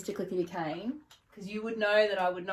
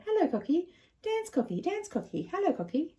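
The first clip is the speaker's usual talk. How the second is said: sing-song to a bird; 8 semitones higher than usual. Acted. Teaching parrot words.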